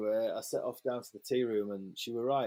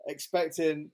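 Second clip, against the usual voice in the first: usual speaking voice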